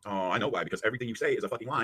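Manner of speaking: ordinary delivery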